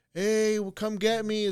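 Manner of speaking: high-pitched, drawn-out imitation of a daughter calling; imitated calling out. Daughter in the water calls to dad for pickup, high mock call.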